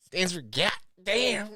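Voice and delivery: Emmy. Retold or imitated. Teasing co-host's slang attempt, mocking imitation. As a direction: drag it out, trying too hard to be hip. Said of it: "When Emmy tries to be hip with the kids".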